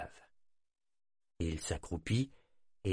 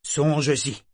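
loud, higher commanding tone; authoritative; 5 semitones higher, 5 dB louder than usual. Acted. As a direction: command him firmly. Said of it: "fit-il d'un ton autoritaire". (ton autoritaire)

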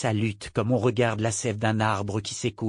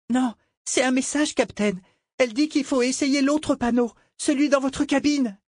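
garçon, voix bien plus aiguë; voix d'enfant; 13 semitones higher than usual. A narrator's reading. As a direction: gamin apeuré qui interpelle le capitaine. Cliff the boy speaks. (Cliff, voix aiguë d'enfant apeuré)

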